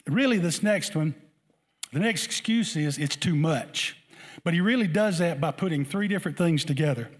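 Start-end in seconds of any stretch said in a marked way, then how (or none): none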